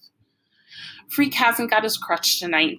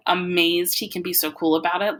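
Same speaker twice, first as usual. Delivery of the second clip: ordinary delivery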